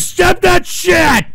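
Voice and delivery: high, loud, slow yell of protest; angry shout. Real, not acted. Streamer yelling at a troll clip, angry shout. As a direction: bellow at the clip to stop.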